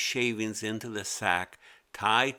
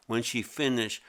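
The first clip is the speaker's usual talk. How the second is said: ordinary delivery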